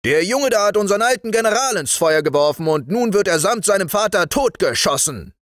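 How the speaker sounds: high, fast accusation; brusque high-voiced military announcement; gruff sergeant. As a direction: rattle off the charge and sentence roughly. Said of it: "fragte der Wachtmeister grob".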